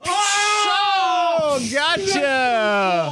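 shocked yelp from ice water; gasping shout as ice water hits; in ice cold water. Real, not acted. Ice water dumped on him.

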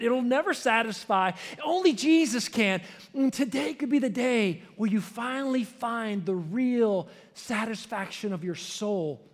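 rising preaching climax. Acted. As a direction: drive the point home with rising power.

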